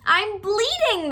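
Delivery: high, loud, slow yelp. A narrator's reading. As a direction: yelp in alarm on waking. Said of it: "the fox yelped".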